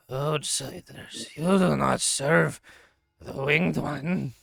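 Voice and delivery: slow, drawn-out otherworldly voice; strange alien voice. Acted. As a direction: speak into their minds slowly. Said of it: "you hear this strange alien voice in your mind".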